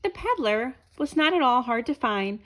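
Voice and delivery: ordinary delivery, nothing marked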